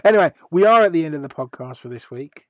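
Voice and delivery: normal talking voice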